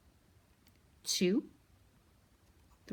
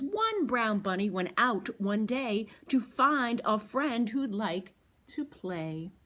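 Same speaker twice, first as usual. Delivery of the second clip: put-on louder, sing-song read-aloud for children; picture book read to toddlers; storytime reading; 4 dB louder than usual. A narrator's reading. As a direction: read it out big for the little ones.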